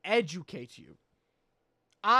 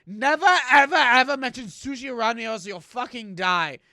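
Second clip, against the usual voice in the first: loud, high, hammed-up threat; mock furious rant; 4 semitones higher, 9 dB louder than usual. Chef rule to viewers, mock furious yelling. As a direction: bellow the rule like a tyrant chef.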